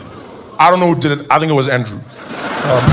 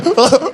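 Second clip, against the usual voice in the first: short high gasping sobs; mock choking, pitched up; choking cry; 7 semitones higher than usual. Shown as a demonstration. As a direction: gulp out a put-on sob. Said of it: "jump in with a choking cry".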